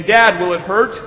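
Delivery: high, loud anxious question; lightly acted crying boy; mock upset kid asking his dad; retold tearful child. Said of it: "Matthew cried some more".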